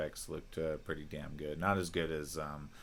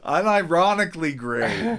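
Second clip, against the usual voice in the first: laughing; 12 semitones higher, 14 dB louder than usual. Real, not acted. Cracking up right after a laugh.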